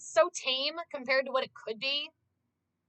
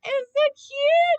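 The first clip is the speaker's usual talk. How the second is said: much higher, louder, slow squeal; gushing squeal; 11 dB louder, slower than usual. Retold or imitated. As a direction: squeal over something adorable. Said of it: "So I was like".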